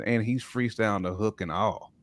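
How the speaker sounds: normal talking voice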